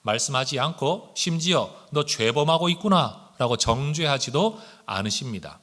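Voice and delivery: ordinary talk, nothing marked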